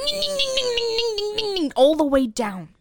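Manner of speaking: squeaky high ding ding sawing sound; high chainsaw noise. Pretend. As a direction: imitate a chainsaw going ding. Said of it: "start ring".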